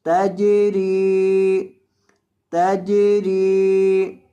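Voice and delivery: तजरी with the रॉ held long. Shown as a demonstration. Teacher demonstrating to students, रॉ of तजरी drawn long. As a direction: stretch the रॉ in तजरी slowly. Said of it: "रॉ को लंबा करें".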